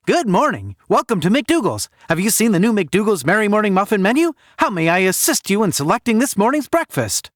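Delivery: fast-food cashier greeting a customer, forced cheer; chirpy cashier. Acted. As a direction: sell the muffin menu like a trained robot.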